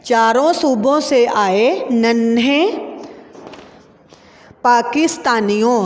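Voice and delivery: slow, word by word; slow classroom dictation; slow dictation reading. Shown as a demonstration. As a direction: read it slowly so children can write.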